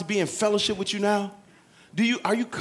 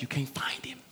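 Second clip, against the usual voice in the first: whispered aside; 3 semitones lower, 10 dB quieter than usual. Real, not acted. Sermon aside, unvoiced.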